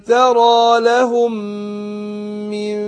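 slow chanted tajweed recitation, heavy nasal hum; Quran recitation. Shown as a demonstration. Teacher demonstrating recitation to students, slow chant. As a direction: recite slowly, drawing out the ghunna.

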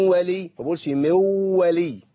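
من ولي said slowly with the nasal hum kept on the ن; slow tajweed demonstration; slow nasal demo. Shown as a demonstration. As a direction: draw out من ولي, holding the ghunna. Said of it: "بقول ايه؟".

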